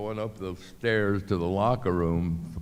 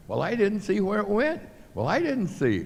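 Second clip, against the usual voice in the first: much higher whiny excuse; mock official excuse; 13 semitones higher, 4 dB louder than usual. Imitating the official who didn't see the throw, whiny. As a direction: whine it like a helpless official.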